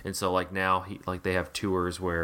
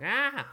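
creepy high gasp. Retold or imitated. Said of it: "voice, you know, like".

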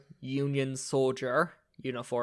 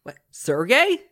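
higher, louder startled recognition; astonished ghost, pitched up and loud; acted surprise; 8 semitones higher, 6 dB louder than usual. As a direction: call out the name in disbelief. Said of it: "And he looks at".

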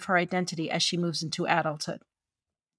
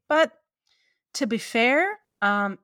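ordinary talk

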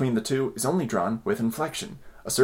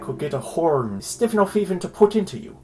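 higher put-on Irish accent, with a laugh at the start; 4 semitones higher than usual. Host reads Joyce's letter in a mock Irish accent. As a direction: read the filthy letter as a lilting Irishman. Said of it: "you want me to do an Irish accent?".

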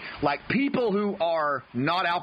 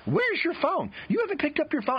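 much higher mock wife, pointed and incredulous; wife's pointed tone; 13 semitones higher than usual. Wife notices at noon he hasn't touched his phone, high mock-incredulous voice. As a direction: play his wife, high-pitched and accusing. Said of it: "you can hear the tone in her voice".

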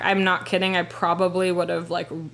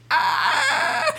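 very high, held cry of pain; mock pained wail. Imitating herself climbing out of car, high pained wail. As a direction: let out a high agonized "ah". Reenacting pain getting in cars.

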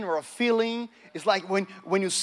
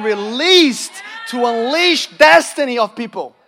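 forceful preaching, building louder; impassioned preaching; 3 semitones higher, 5 dB louder than usual. Real, not acted. Preacher urging a congregation about the prophetic, impassioned and building. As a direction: drive it up to a loud, slowed peak.

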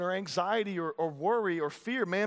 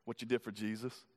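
much lower and softer, a grave answer; solemn hush; 10 semitones lower, 10 dB quieter than usual. Acted. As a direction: let it sink low and quiet. Solemn climax of the point.

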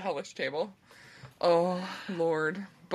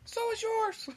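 high squeaky comeback; squeaky silly voice; 4 dB quieter than usual. Pretend. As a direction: snap back in a squeaky voice. Mock insult banter.

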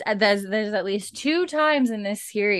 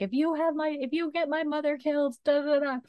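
mock yelling daughter; 9 semitones higher than usual. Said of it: "all yelling at him and pissed".

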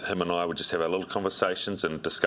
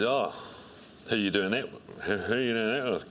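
light impression of the big boss, pitched higher; mock the head coach teasing in front of staff, a touch higher; lightly voiced coach, raised pitch, probing and showy; imitating Steve Hansen; 5 semitones higher than usual. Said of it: "that's how he speaks".

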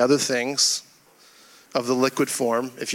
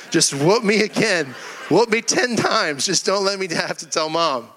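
desperate plea; 5 semitones higher than usual. Retold or imitated. Son silently begging dad to spare him telling Mom, desperate plea. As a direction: plead in escalating, hammed-up desperation.

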